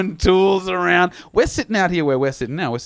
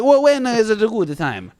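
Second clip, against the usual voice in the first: higher-pitched mock Italian accent; 5 semitones higher than usual. Plumber asking tenant for a good time, mock Italian accent. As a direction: imitate a put-out Italian plumber. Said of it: "He's Italian".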